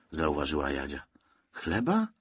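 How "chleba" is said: high, rising surprised question; puzzled echo with a lifted end. A narrator's reading. Zosia questions the search for bread, surprised. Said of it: "zdziwiła się Zosia".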